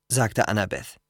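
usual speaking voice

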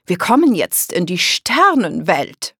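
loud, sweeping announcement; calling out. A narrator's reading. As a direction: announce it to the whole group. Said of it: "rief Oma Rose". (laut verkündender Ruf)

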